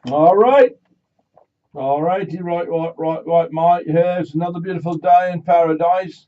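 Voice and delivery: hammed-up Australian "all righty, mate"; Australian accent. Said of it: "I don't think I'd try an Australian accent".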